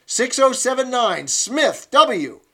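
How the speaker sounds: loud, high, hectoring commands; scolding instructress. Acted. Instructress scolding Winston through the telescreen, loud and hectoring. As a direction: berate him in front of the squad.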